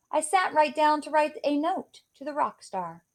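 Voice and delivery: usual speaking voice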